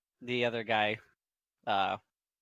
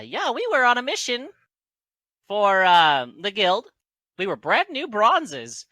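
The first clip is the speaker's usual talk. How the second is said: high, loud halfling storyteller; Garth; 9 semitones higher, 9 dB louder than usual. Acted. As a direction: speak up in a raised, piping voice. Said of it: "Garth just speaks up".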